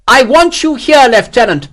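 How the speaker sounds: high, loud demand; insistent shout. A narrator's reading. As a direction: order your lieutenant back, shouting. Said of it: "Morris insisted".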